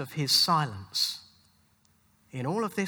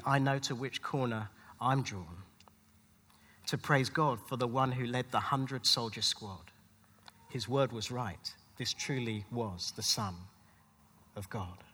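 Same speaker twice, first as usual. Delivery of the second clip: quiet close; 8 semitones lower, 8 dB quieter than usual. Acted. Preacher closing the sermon, hushed. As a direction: lower and softer, sinking low.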